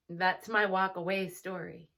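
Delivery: ordinary delivery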